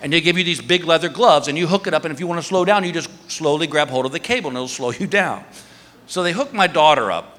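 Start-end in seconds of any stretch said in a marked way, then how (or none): none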